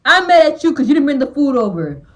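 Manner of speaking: mock the husband's whiny complaint; a hint of a sulking man; mock angry husband.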